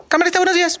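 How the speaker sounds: muy agudo y cantado; camarista chillona. Retold or imitated. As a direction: exagera el sonsonete hasta chillar. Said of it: "lo hacen con un tonito". (camarista chillando el saludo en el pasillo)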